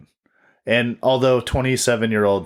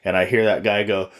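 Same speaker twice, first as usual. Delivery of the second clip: ordinary talk, nothing marked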